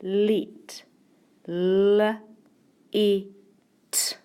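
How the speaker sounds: "Let", then "l", "e", "t" as separate sounds with pauses; sounding out. Shown as a demonstration. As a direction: break "Let" into held "l", short "e", crisp "t". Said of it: "Let's do the sounds".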